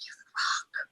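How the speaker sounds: breath of mock awe after a sigh; hushed, starstruck re-enactment; awestruck whisper. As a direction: whisper it as if overwhelmed. Overwhelmed on meeting him, retold.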